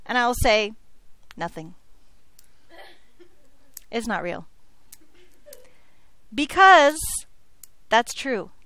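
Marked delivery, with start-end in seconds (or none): 1.3-4.4 low, hushed mock confession; sheepish admission. Teacher playing herself admitting it to the principal, sheepishly quiet. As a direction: own up very softly, pitch dropped.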